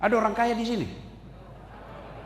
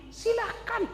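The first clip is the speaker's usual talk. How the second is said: ordinary talk, nothing marked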